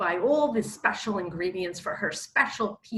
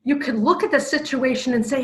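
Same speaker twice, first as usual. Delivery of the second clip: ordinary talk, nothing marked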